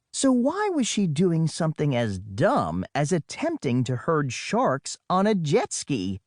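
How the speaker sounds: plain reading voice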